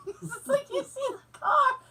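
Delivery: laughing, high and slow through it. Real, not acted. Laughing at Russian crash videos, high and slow. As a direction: giggle the wee out. Laughing describing sliding car.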